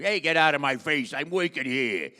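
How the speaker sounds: loud, brash Brooklyn Italian shout; Brooklyn Italian accent. Acted. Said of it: "what does an Italian from Brooklyn sound like".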